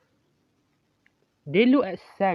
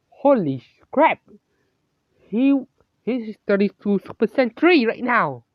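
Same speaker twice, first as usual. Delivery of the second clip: loud, higher-pitched amazed outburst; astonished exclamation; 5 semitones higher, 6 dB louder than usual. Acted. Cross reacts to Sen's Super Saiyan change, astonished outburst. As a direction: exclaim in astonishment, stumbling on the first word.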